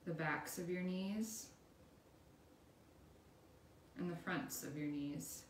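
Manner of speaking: guided meditation. Acted. Instructor leading listeners through a body scan, calm guided meditation.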